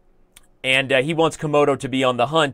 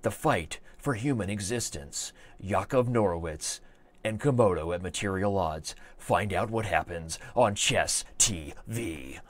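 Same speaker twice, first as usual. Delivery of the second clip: movie trailer voice; 6 semitones lower, 9 dB quieter, slower than usual. Pretend. Mock hype trailer for chess match.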